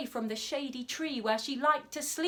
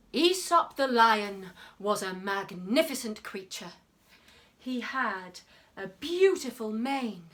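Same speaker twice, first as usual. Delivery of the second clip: storytelling; 4 semitones lower than usual. A narrator's reading. Reading a children's story.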